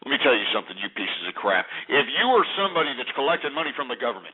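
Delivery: low, contained anger; seething warning. Real, not acted. Host warning welfare collectors among listeners, seething. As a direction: hold the anger down, pitched low.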